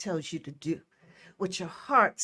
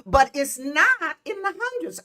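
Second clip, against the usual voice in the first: laughing through the words, much higher; 7 semitones higher, 7 dB louder than usual. Real, not acted. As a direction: crack up at the heat joke. Laughs about hot weather.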